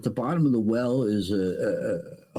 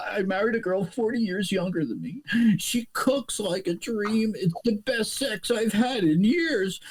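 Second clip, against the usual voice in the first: put-on old man in joke; 8 semitones higher than usual. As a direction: tell it like a contented old timer. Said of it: "the old man says".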